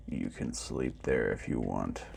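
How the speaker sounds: deep, hushed, gentle robot. A narrator's reading. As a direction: offer it kindly, like a big old machine. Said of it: "the robot said gently".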